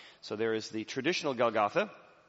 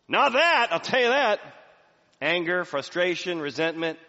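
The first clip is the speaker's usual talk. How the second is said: loud retort; 7 semitones higher, 9 dB louder than usual. Acted. Pastor contrasting human anger, loud and high. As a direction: shout it, much higher and louder. Crowd's reaction, not forgiveness.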